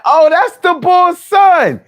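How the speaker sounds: high, slow put-on street voice; mock New York hype greeting, high and drawn out; New York homie greeting. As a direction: play a Harlem guy hyping up the Bull's son. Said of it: "who's gonna be like".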